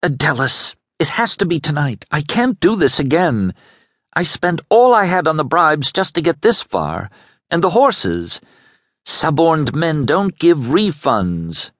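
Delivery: high-pitched, desperate urging; frenzied pleading. A narrator's reading. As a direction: plead urgently, pitch held high throughout. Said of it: "so frenzied she nearly bit his shirt".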